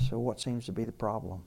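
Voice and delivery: low, soft, even question; calm, unraised voice. Retold or imitated. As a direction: ask calmly, keeping the voice down. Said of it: "he never raises his voice".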